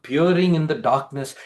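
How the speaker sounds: ordinary delivery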